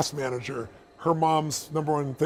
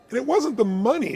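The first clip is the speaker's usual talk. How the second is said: normal talking voice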